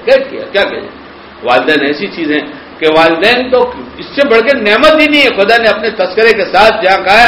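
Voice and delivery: ordinary talk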